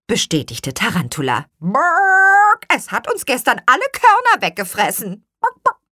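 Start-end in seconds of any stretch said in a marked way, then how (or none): 1.6-5.8 high, loud hen squawk; clucking hen. Acted. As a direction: tattle like a squawking chicken.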